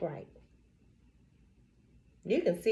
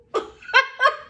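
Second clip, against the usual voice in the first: loud high laugh; 7 dB louder than usual. Real, not acted. Laughing at the customer's threat to slap her, loud laugh.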